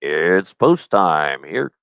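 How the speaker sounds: very slow, drawling post-time call; race announcer impression. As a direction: stretch every word like a track announcer. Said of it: "and then he'd be like".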